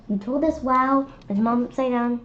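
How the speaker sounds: ordinary delivery, nothing marked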